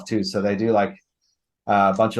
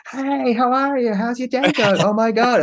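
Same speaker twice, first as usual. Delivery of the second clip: high, slow, sugary fake-cheerful greeting; mock the over-friendly barista voice; chirpy, gushing customer service voice; overeager barista; 5 dB louder than usual. Mocking overly cheerful barista.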